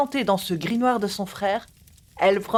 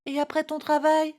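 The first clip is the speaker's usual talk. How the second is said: put-on higher, lightly childlike question; enfant qui insiste; 7 semitones higher than usual. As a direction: press hopefully in a lightly raised child voice. Enfant insiste auprès du parent. (question d'enfant, voix un peu haut perchée)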